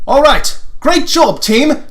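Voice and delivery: high, loud cheer to the team; cheery team leader. Acted. Leader praising the team after a mission, loud and bright. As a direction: call out praise like a proud leader.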